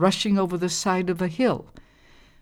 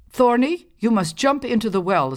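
high, loud, bossy mother voice; stepmother; 6 semitones higher, 4 dB louder than usual. A narrator's reading. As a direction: order the girl into the well. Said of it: "said the mother".

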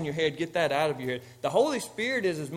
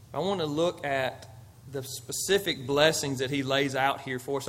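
normal talking voice

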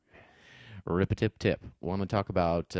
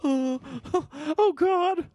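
high, shaky freezing gasps; shivering; slower than usual. Pretend. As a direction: teeth chattering from the cold. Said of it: "My teeth are chattering".

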